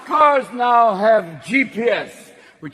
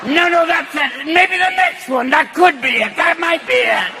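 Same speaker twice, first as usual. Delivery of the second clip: put-on old man GPS; 6 semitones higher than usual. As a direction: yell like a confused grandpa. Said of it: "a G P S that ages with you".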